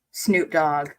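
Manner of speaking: usual speaking voice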